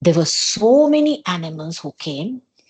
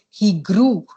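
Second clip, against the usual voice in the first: plain reading voice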